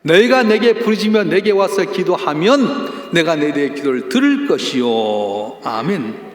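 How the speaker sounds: loud, high sermon climax; full-force preaching. Acted. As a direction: preach it out to the congregation. Reading scripture, preaching.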